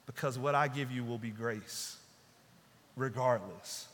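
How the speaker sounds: hushed, unhurried answer from a kind master; gentle Jesus. Acted. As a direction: speak as Jesus, quietly certain of grace.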